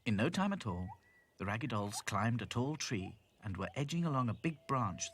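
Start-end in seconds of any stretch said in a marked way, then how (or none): none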